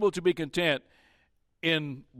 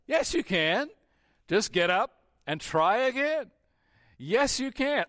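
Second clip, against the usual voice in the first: much higher, bright coaxing parent voice; encouraging parent; 8 semitones higher than usual. Acted. Parent urging a small child to try again, coaxing. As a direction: cheer a child back onto their feet.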